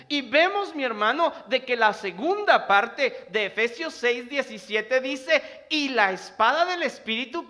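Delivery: impassioned Spanish sermon; fervent preaching. Acted. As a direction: preach it out, loud and driving. Preaching at full force.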